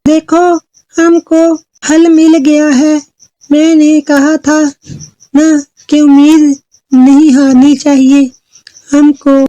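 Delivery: high little fairy voice; Chutki. Acted. Little fairy tells trapped girl of the solution, high hopeful voice. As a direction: announce hope in a high, measured voice. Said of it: "चुटकी परी ने चंचल से कहा".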